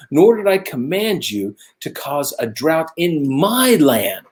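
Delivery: high-pitched, emphatic scolding as God; exasperated rebuke. Acted. God tells Elijah he never commanded the drought, exasperated rebuke.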